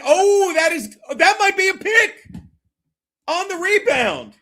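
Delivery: loud, high game-watching outburst; excited shout. Real, not acted. Streamer watching a possible interception live, excited shout. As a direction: cry out as the play happens.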